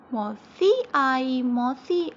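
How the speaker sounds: slow, loud, sing-song poem reading; poem recital. Shown as a demonstration. Teacher reciting a children's poem, slow and loud. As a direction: recite the nursery rhyme slowly. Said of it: "चलिए कविता पढ़ते हैं".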